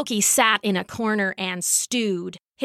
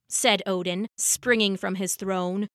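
ordinary delivery

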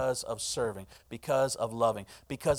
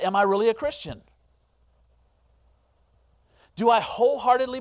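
loud, high preaching; impassioned preaching; 7 semitones higher, 6 dB louder than usual. Real, not acted. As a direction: build the challenge. Preaching at full force.

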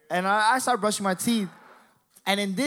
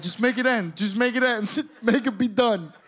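mock sobbing plea, pitched up; tearful begging; 5 semitones higher than usual. Crying kid begging God in the bathroom, high mock wail. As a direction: plead like a bawling kid. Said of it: "I'm begging God".

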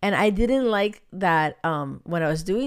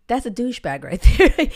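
louder, laughing through the punchline; amused, loud quip with a laugh; 4 semitones higher, 8 dB louder than usual. Real, not acted. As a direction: crack up on the last word. Laughs at her own quip.

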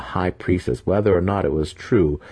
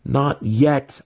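higher, louder angry growl; 9 semitones higher, 5 dB louder than usual. A narrator's reading. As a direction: snarl a defiant denial. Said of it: "Alton growled".

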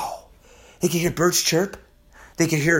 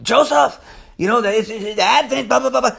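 higher-pitched mock objection; mock complaining caller; 5 semitones higher than usual. Seventh-day Adventists calling up the preacher to object, lightly mocked. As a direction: lightly mimic a nagging objector. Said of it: "gonna be calling me up saying".

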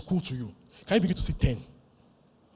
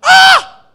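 huge high war shout; battle cry; 23 dB louder than usual. Retold or imitated. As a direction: charge in screaming.

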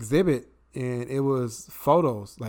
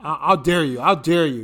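mock dare; 6 dB louder than usual. Playful mock challenge.

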